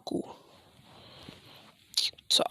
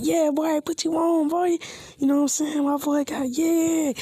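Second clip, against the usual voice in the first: hyped-up bragging; 7 semitones higher than usual. Retold or imitated. Naruto hyping up Shino after his date, excited and higher. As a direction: brag it up like a proud wingman.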